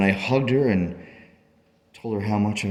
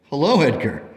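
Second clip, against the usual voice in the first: higher-pitched anxious questioning; parent greeting Edgar, surprised; 7 semitones higher, 5 dB louder than usual. Acted. Parent finding Edgar about to leave town, surprised. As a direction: ask with rising urgency.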